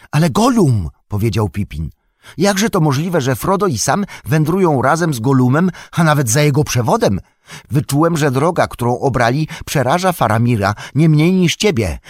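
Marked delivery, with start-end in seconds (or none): 0.1-0.9 high, loud outburst as Pippin; agitated protest. A narrator's reading. Pippin insists despite being hushed. 2.4-12.0 high, loud, urgent questioning; agitated disbelief. A narrator's reading. Pippin's alarmed questioning of Gandalf.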